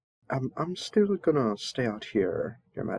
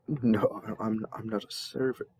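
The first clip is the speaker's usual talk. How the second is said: ordinary talk, nothing marked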